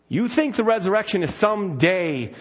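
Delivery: ordinary talk, nothing marked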